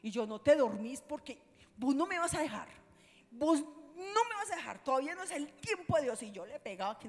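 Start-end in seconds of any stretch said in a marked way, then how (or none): 0.3-6.2 louder, higher acted-out plea; retold desperate insistence; urgent pleading command. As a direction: order him forcefully to stay awake. Acts out pleading over injured husband.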